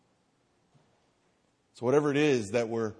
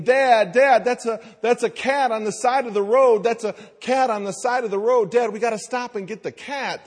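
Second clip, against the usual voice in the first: put-on much higher, louder begging child voice; pleading kids; 12 semitones higher, 8 dB louder than usual. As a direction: beg like excited kids spotting a cat. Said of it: "they start saying".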